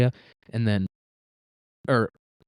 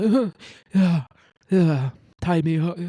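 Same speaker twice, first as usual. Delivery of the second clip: louder, higher, slow moaning yeah; drawn-out breathy-sounding pleasure, hammed up; mock aroused hostage; 6 semitones higher, 5 dB louder, slower than usual. Hostage mock-enjoys being tied up, slow and louder. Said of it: "he might".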